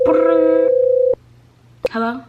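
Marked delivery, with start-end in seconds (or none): 0.1-0.7 loud, high trilled ring; imitated phone ring. Storyteller calling mom, imitated ringing phone.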